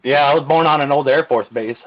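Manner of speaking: ordinary delivery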